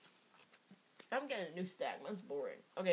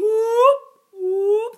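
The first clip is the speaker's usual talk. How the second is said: high loud whooping scream; mock scream; 13 semitones higher, 14 dB louder than usual. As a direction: whoop like a siren. Imitating night-terror scream.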